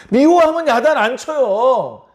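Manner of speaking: ordinary talk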